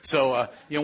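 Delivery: normal talking voice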